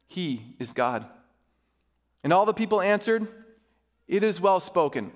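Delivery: measured, emphatic public Bible reading; Scripture reading. A narrator's reading. Pastor reading Elijah's showdown to his church, emphatic Scripture reading. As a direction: read it out formally, phrase by phrase.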